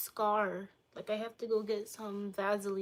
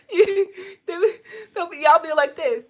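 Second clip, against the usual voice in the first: loud, high, through laughter; cracking up; 9 semitones higher, 13 dB louder than usual. Real, not acted. Streamer overcome by their own joke, laughing loudly. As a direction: struggle to talk while laughing.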